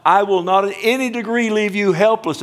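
high and loud, proclaiming the promise; soaring emphatic preaching; rising preacher's cry. Acted. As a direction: lift it up and ring it out over the church. Preacher climax on repeated promise.

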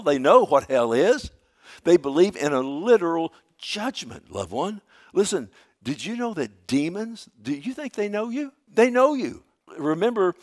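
loud, high-pitched preaching with punched phrases; fired-up sermon delivery; emphatic preaching. Acted. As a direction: drive each point home to the congregation. Preacher at full force.

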